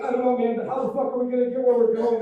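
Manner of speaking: loud, high-pitched mock exasperation; hammed-up, worked-up complaint; frustrated turd. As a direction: shout it like a stranded buddy. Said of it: "One of the other one's like".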